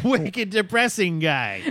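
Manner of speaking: mock Boston accent, stretching wicked. Husband teasing wife in a mock Boston accent. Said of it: "That's the Boston accent in your head".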